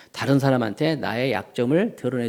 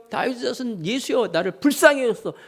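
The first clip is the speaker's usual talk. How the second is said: retold crying out; 7 semitones higher than usual. Blind Bartimaeus begging Jesus for mercy, mock crying out. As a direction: cry out for pity in a raised pitch. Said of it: "소리 지르면서 뭐라고 얘기해요".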